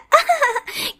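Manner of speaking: put-on a loose, drunk little laugh; drunken laugh. Drunk Payal laughs as she stumbles in. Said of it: "टल्ली होकर पायल गाते हुए आती है".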